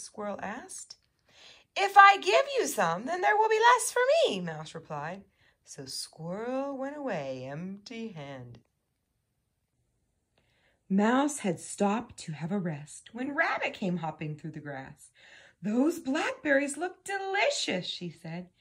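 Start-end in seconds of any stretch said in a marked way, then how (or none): 1.8-4.4 high, loud mouse voice; selfish mouse. A narrator's reading. Mouse refuses Squirrel, high and selfish. 15.6-17.8 high-pitched rabbit voice; admiring rabbit. A narrator's reading. Rabbit admires Mouse's berries, high and keen.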